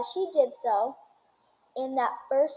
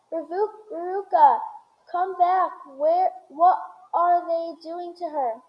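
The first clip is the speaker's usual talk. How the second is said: higher, louder alarmed calling; screaming; 4 semitones higher, 4 dB louder than usual. A narrator's reading. Mother calling after her captured daughter, raised cry. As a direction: call out in alarm, pitched up. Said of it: "screamed Missus Salt".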